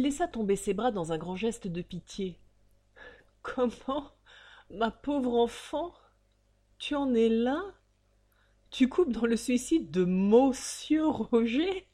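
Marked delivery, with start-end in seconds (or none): 3.4-11.9 scornful pity. Acted. Father mocks her belief.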